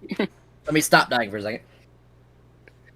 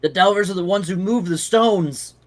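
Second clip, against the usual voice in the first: fearful; 6 semitones higher, 3 dB louder, slower than usual. Acted. Frightened man explains Delvers.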